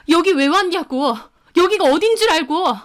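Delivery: high, heated outburst; angry demand. Acted. Woman confronting boyfriend at rival's shop, angry.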